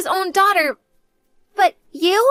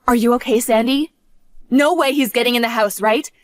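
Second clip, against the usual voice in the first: normal talking voice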